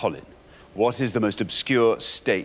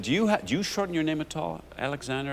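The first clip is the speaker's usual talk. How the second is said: imitating an American producer, pitched up; mock American, friendly and polite; American accent; 6 semitones higher, 4 dB quieter than usual. Host imitating an American producer asking about his name, light American accent. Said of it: "with an American producer".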